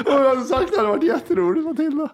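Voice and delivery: high-pitched, amused, laughing speech. Real, not acted. Host teasing Matilda about the misheard word, laughing. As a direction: keep laughing as you talk.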